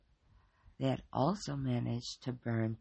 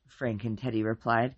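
usual speaking voice

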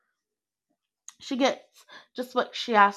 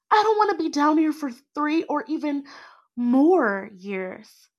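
higher, louder, pushing back frustrated; 5 semitones higher, 4 dB louder than usual. Acted. Trapped girl protesting to her cellmate, frustrated and raised. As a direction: voice climbing in frustration. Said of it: "I say, frustrated".

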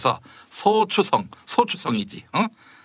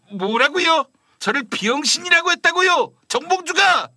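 shocked outrage; 8 semitones higher than usual. Acted. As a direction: sputter it, appalled at the insult. Shocked reaction to insult.

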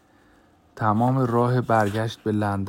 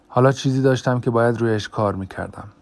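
usual speaking voice

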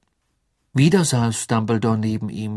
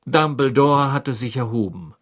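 ordinary delivery

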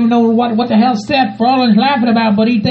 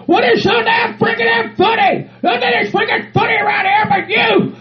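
high-pitched exasperated rant; indignant outburst; 6 semitones higher than usual. Acted. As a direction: demand answers, voice pitched right up. Confronts the man laughing at him.

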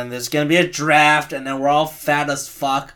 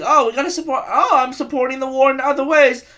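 louder and much higher, a smug arms dealer's put-on voice; mock gun maker; 10 semitones higher, 4 dB louder than usual. Gun maker justifying the war, hammed-up smug voice. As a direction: play the greedy gun maker, pumped and phony. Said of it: "and then just like".

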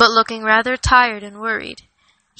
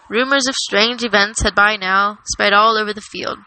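plain reading voice

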